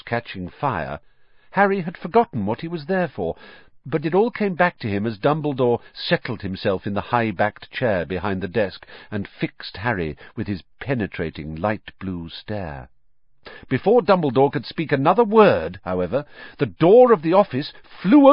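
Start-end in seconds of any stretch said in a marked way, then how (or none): none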